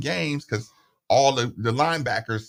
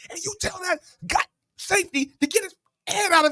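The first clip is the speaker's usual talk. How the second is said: yelling coach. Retold or imitated. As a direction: bark the order like an angry coach. Coach screaming at the safety.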